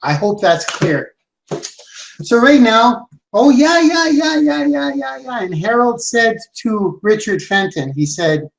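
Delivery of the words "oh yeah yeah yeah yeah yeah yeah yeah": mock agreement. Mocking agreeable prospect.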